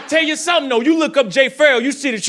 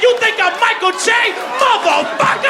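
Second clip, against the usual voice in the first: outraged yell; 10 semitones higher than usual. Retold or imitated. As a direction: blow up in disbelief. Outraged at being mistaken.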